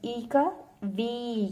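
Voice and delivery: वी drawn out slowly with a long ee; teacher drawing out वी slowly; slow sound demo. Shown as a demonstration. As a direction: stretch the ee in वी. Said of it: "जैसे".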